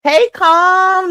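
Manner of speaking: high, loud happy hey; light up greeting a friend; calling out a cheerful hey to a viewer; excited greeting. Real, not acted.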